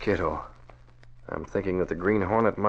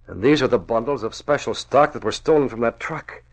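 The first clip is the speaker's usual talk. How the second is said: normal talking voice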